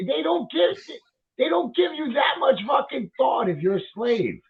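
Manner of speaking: high, shouted outrage; outraged shout. Real, not acted. Outraged rant.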